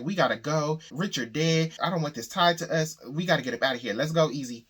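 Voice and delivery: panicked woman hurrying Easy away from a dead body; freaking out. Retold or imitated. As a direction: push him to leave, frantic and scared. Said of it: "She freaking out".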